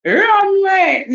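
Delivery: high, loud Jamaican call; Jamaican accent. Acted. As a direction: chase them off in a Jamaican accent, drawn out. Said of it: "That's what they say in Jamaica".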